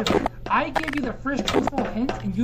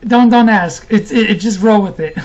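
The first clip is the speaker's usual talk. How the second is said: loud; 3 semitones lower, 9 dB louder than usual. Real, not acted. Loud deflection.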